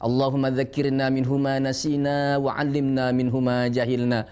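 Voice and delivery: reverent recited prayer; closing prayer. Acted. Teacher closing the lesson with a recited prayer, reverent. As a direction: recite the closing supplication devoutly.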